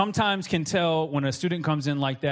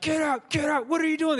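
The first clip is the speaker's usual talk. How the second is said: startled shout; 13 semitones higher than usual. Retold or imitated. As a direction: yell in shock at the stranger. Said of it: "He's like".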